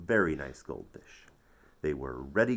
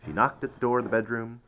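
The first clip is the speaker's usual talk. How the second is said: ordinary delivery, nothing marked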